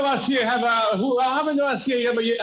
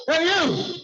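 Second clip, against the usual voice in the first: higher, sharp call of hey you; retold shout; 7 semitones higher than usual. Officer calling the preacher back, mock shout. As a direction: call out like an officer stopping someone. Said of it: "And he shouts".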